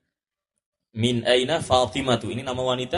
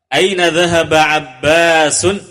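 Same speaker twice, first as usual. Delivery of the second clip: slowed, louder reading of the question; slow drill question; 3 semitones higher, 8 dB louder than usual. Shown as a demonstration. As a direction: read it out for pupils to answer. Teacher models the drill question.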